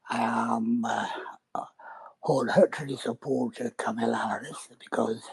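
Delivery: breathy whisper. Acted. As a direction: Tesfaye in a hushed whisper. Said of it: "why are you whispering".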